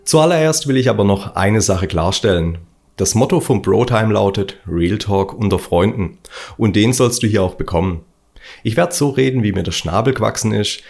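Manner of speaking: ordinary talk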